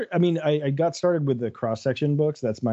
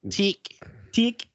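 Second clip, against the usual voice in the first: higher mock New Zealand accent; a playful Kiwi accent, pitched up; 8 semitones higher than usual. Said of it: "That's our best New Zealand accent".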